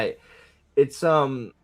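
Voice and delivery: ordinary talk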